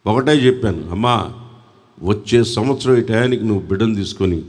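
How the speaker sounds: low, soft retelling of an encounter; hushed story narration. Real, not acted. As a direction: drop the voice to tell the story intimately.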